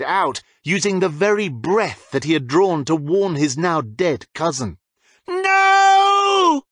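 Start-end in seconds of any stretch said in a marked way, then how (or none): 5.3-6.6 long, high, loud anguished scream; shriek. A narrator's reading. James seeing his cousin killed, a long high loud scream. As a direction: tear the no out of him. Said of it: "he shrieked".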